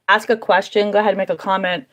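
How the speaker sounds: slightly dry, rough throat; speaking with a dry throat. Real, not acted. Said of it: "My throat is dry".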